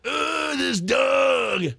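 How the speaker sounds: mock exasperated groaning yell; high, drawn-out comic impression of fury; imitating pissed-off actor. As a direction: act out grumpy annoyance at a dog. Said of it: "pissed the whole time. He's like".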